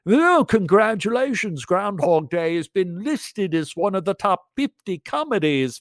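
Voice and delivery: high, gushing mock interviewer voice; BBC interviewer. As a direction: put on an over-excited, high-pitched congratulation. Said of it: "the B B C interviewed me".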